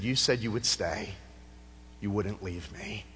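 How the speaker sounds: quiet Tony voice. Retold or imitated. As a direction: drop to a soft, low recollection. Tony recalls counselor's promise.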